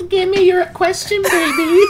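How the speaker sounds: high, laughing come-hither voice; sultry voice. Acted. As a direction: coo it like a flirty hostess. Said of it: "I don't know why I just did that".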